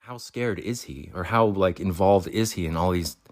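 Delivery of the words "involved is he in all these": ordinary talk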